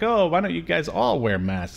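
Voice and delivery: ordinary talk, nothing marked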